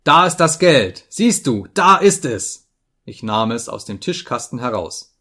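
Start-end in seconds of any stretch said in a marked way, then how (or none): 0.0-2.7 loud, high, taunting display; heated, raised-voice taunt; angry shouting. A narrator's reading. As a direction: thrust the words at someone in fury. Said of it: "schrie ich ihn an".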